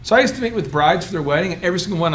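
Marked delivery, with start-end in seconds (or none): none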